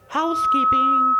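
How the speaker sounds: high, loud, slow maid call; mom impression. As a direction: call it out like a hotel maid, drawn out. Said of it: "I have this impression of my mom".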